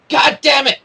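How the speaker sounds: sudden high yelp of a curse, thumb caught; pained curse. Acted. Adventurer cursing as the rope catches the thumb.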